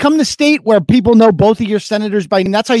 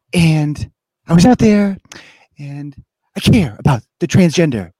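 Joe Kennedy; 3 semitones lower than usual. Retold or imitated. Co-host mocking a politician's annoying voice, halting impression. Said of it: "Joe Kennedy, he talks like this".

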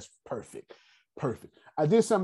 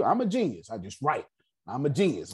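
ordinary talk

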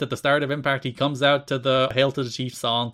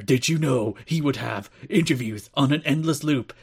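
mock ominous campaign ad; political attack ad voice. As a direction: sell it like a smear ad. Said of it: "the full political attack ad".

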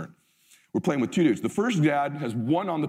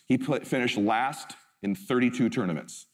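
normal talking voice

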